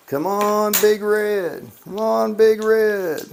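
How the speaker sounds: root for the seven, pitch up and stretch it; cheering on the dice. Real, not acted.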